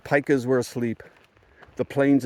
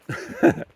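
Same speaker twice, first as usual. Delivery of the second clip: short high breath of laughter; brief chuckle to round off the story; 3 dB louder than usual. Real, not acted. Laughs after wrapping up story.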